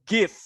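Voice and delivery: "give" with an audible f-like ending; correct GIVE. Shown as a demonstration. Teacher modelling the word for students, "give" with its ending sounded. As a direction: finish "give" on its final fricative. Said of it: "say the word give".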